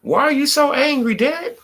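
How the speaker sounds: high-pitched mock question, teasing; playful high taunt. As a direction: lift the voice up high to tease him. Mocking someone's reaction on stream.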